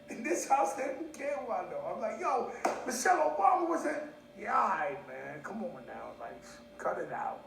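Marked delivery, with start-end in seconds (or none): 2.2-4.0 high-pitched retold excitement; worked-up, incredulous announcement; excited boasting. Retold boast to unimpressed family. 4.4-7.4 low, soft, unimpressed brush-off; mock-bored dismissal, dropping low; imitating dismissive family.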